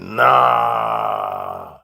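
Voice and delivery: a very low drawn-out nah; low dismissive nah; deep nah. Retold or imitated. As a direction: drop the voice way down on nah. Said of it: "I'm like".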